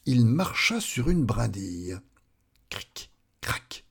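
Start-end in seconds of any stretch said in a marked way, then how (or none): 2.7-3.8 quiet, voiceless crick-crack; snapping twig noise. A narrator's reading. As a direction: imitate a small twig breaking. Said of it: "fit la brindille". (petit craquement de brindille chuchoté)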